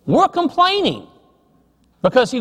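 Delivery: high, whiny mock-complaining voice; sing-song whiny mockery of the kids' complaints; mock whine. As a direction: whine it like a sulky teenager. Sons grumbling about early work.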